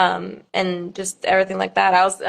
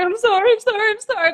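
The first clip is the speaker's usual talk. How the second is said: much higher, panicked repeated sorry; frantic apology; 14 semitones higher than usual. Retold or imitated. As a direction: blurt out sorries in a half-awake panic. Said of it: "I immediately just heard".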